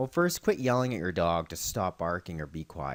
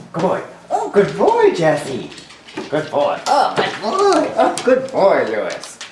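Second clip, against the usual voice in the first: high, loud gushing praise, drawn out; lavish the dogs with delighted praise; excited dog praise; 9 semitones higher, 11 dB louder than usual. Real, not acted. Praising dogs as they arrive.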